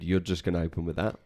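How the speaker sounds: normal talking voice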